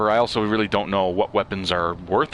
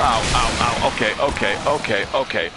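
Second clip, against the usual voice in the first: flustered pained yelps; 3 semitones higher, 3 dB louder than usual. Real, not acted. Being hit by enemy.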